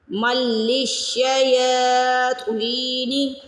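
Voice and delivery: مل للشیاطین with a heavy, drawn-out ط; heavy, stretched taa. Shown as a demonstration. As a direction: recite مل للشیاطین slowly, meem joined to laam. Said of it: "خوا کو موٹا بھی پڑھنا ہے". (للشیاطین میں طا موٹی اور کھینچ کر)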